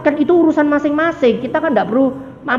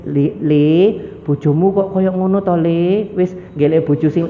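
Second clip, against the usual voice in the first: lower, hushed fatherly counsel; gentle old father; 7 semitones lower, 3 dB quieter than usual. Retold or imitated. Ibrahim privately advising his son, lowered gentle fatherly voice.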